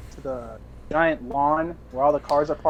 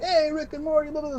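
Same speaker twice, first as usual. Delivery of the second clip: put-on New Zealand accent; 11 semitones higher than usual. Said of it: "I was doing an accent".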